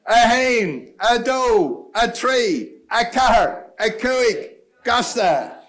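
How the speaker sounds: counting down in Irish, called out loud to the room; loud countdown. Real, not acted. Host calling the room to start, loud countdown. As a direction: shout the count like a starting gun.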